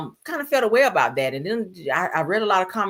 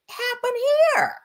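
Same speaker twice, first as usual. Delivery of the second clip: shocked outburst. Retold or imitated. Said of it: "I said".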